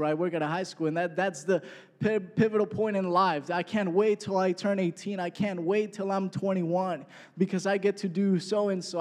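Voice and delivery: ordinary talk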